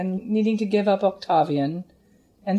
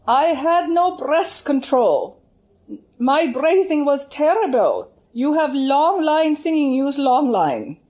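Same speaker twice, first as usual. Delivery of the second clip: put-on higher, slow German-accented insistence; German teacher pressing her point, not angry; Lehmann's German accent; 6 semitones higher, 4 dB louder, slower than usual. Teacher explains her own poor breathing to the student, German accent. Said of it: "that's how she'd say".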